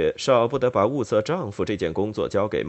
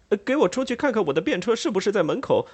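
much higher, fast stammering plea; 便车 rushed out high and fast in a panic; embarrassed Joseph asks for his carriage, flustered; 10 semitones higher, faster than usual. Acted. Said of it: "越发觉得狼狈不堪".

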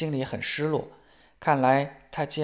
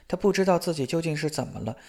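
ordinary delivery

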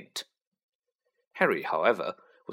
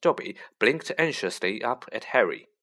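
ordinary delivery

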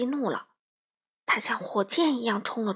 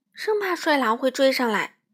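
ordinary delivery, nothing marked

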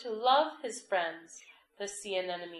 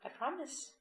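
little crab voice; 7 semitones higher, 4 dB quieter than usual. A narrator's reading. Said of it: "said the little crab".

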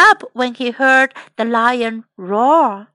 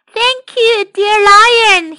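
high, ringing thanks; mouse calling out; 7 semitones higher, 6 dB louder, slower than usual. Acted. Happy mouse thanks the lion running home, calling out. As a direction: call it out gratefully in a high voice. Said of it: "he called".

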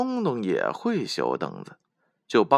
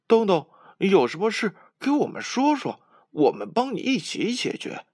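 put-on higher, eager children asking together; anxious asking; 11 semitones higher than usual. A narrator's reading. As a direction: urge him to share his worry. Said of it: "着急地问". (小伙伴们着急地追问东东，声音高亢)

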